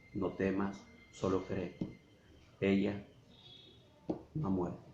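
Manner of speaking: softly, Jesus calming a frightened father; gentle reassurance. Acted. Jesus comforts grieving father.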